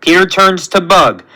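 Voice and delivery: usual speaking voice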